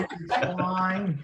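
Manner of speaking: slow, broad put-on Aussie accent. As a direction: drawl it like a hammy Aussie. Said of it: "Give us your best Aussie accent".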